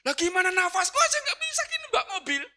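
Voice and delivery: high, strained exclamation; desperate protest. Retold or imitated. Breathless husband protests to wife about driving, desperate. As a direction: protest urgently with failing breath.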